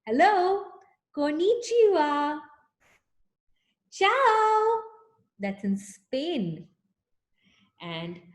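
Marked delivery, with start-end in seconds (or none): 0.1-2.4 bright, higher and louder greeting to a class; a lively higher "Hello, konnichiwa" for a class to copy; cheery greeting. Shown as a demonstration. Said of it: "greet this way". 3.9-4.8 "Ciao" sung out much higher and louder; a gleeful, much higher "Ciao"; cheery greeting. Shown as a demonstration. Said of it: "greet this way".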